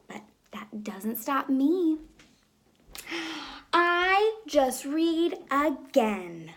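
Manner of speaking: put-on lively storybook read-aloud for kids; reading to children. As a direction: read it like story time in class. Teacher reads picture book aloud.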